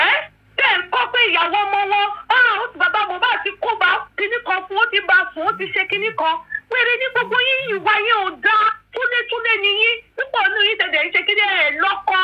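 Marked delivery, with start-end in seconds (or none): none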